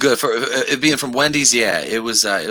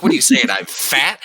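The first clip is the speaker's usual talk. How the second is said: mock offended girlfriend; 5 semitones higher than usual. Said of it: "And she's like".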